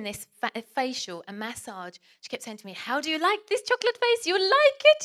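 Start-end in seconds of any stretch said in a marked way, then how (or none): 2.7-5.0 high, loud mock foreign beautician; excited, high-pitched caricature of a spa worker; eager spa woman. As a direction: pipe it up loudly as an over-keen therapist. Spa woman's repeated question, acted out.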